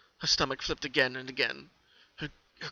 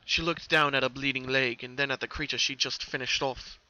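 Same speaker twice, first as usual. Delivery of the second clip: plain reading voice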